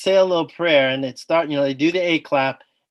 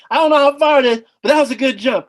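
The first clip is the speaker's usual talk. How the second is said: elated; 8 semitones higher, 6 dB louder, faster than usual. Retold or imitated. Elated after landing the jump.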